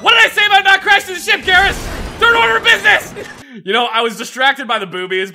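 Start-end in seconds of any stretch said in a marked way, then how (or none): 0.0-1.7 loud exasperated scolding; angry shout. Pretend. Scolding crew after crash. 2.2-3.1 shouted louder and higher; shouting. Pretend. Repeats order, louder.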